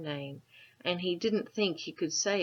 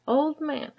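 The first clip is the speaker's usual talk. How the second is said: calling out; 10 semitones higher, 8 dB louder than usual. A narrator's reading. Shouting to old man.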